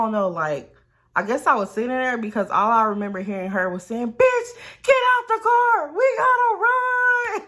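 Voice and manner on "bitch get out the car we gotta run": high, loud, drawn-out holler; urgent yell. Retold or imitated. Storyteller voices friend after the crash, urgent yell to run. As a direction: yell it high and long, urging escape.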